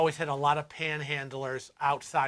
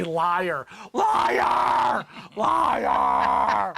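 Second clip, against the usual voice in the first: mock shout; 2 semitones higher, 7 dB louder, much slower than usual. Street vendor yells at a tourist who claims no money, loud shouts. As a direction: bellow Liar like a street vendor calling out a cheat. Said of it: "He shouted to me".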